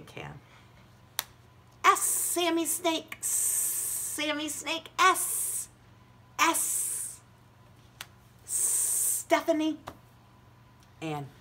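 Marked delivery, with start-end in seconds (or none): 1.8-9.8 high, hissy snake puppet voice; Sammy Snake voice. Acted. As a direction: stretch the S like a snake. Hissing snake letter sound.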